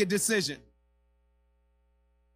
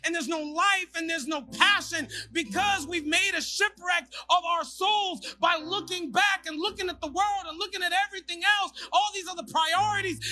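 higher, building preacher's shout; preaching at full force; 13 semitones higher, 4 dB louder than usual. Acted. Pastor urging the congregation not to look back, rising shout. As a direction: drive it home to the crowd.

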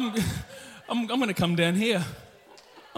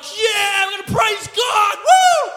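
higher, whooping cheer; excited worshipper; 8 semitones higher, 6 dB louder, slower than usual. Retold or imitated. Pastor mimicking the congregation's excitement. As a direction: cheer like the crowd when the song hits. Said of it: "everyone was like".

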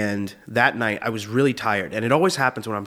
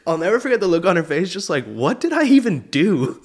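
laughing; 7 semitones higher, 4 dB louder than usual. Real, not acted. Cracking up recalling girlfriend's shocked face.

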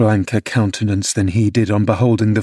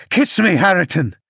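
higher, insistent command; mild demanding shout; 7 semitones higher than usual. Acted. Father ordering his son to kiss him, higher and insistent. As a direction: push the order, pitch up.

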